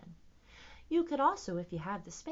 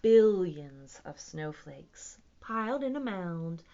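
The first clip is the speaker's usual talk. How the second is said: slow, expressive picture-book reading; animated read-aloud, building with excitement; story narration; 4 semitones higher, 12 dB louder, slower than usual. A narrator's reading. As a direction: stretch the words for young listeners. Reads picture book aloud to children.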